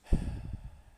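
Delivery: long loud breath out; a deep audible sigh; loud exhale. Shown as a demonstration. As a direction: release the breath loudly. Model breath release.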